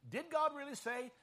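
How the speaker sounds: high-pitched doubting question. Retold or imitated. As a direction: imitate a tempter casting doubt. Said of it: "First, Satan questioned".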